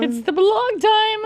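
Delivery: big playful announcement of a segment; hyped segment call. Pretend. Host announcing her blog segment, hyped and high.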